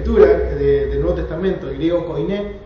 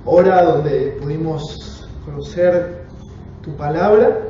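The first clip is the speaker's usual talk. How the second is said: earnest congregational prayer, settling quieter toward the amen; 3 semitones lower, 4 dB quieter than usual. Real, not acted. Teacher praying to God before the Bible class, prayer. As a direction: pray reverently, in unhurried phrases with pauses.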